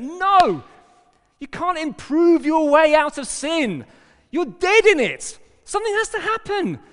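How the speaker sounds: high, loud, impassioned; a shouted rebuke from the pulpit; preaching shout. Real, not acted. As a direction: hammer it home at full force.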